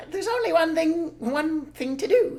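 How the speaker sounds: high, slow scheming voice; slow high crafty farmer; scheming Bean. Acted. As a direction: plot it out slowly. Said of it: "he said".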